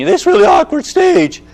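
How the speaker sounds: cracking puberty voice. Shown as a demonstration. Mimicking a boy's voice breaking.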